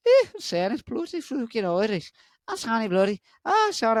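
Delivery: high, hammed-up Irish-Scottish accent; put-on accent. Vlogger mocking British Isles voices, exaggerated Irish accent. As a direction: swear in a thick mock Irish brogue. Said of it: "I did all these accents".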